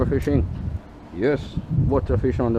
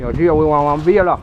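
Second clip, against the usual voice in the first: calling out; 3 semitones higher, 6 dB louder than usual. Real, not acted. Calling to friend.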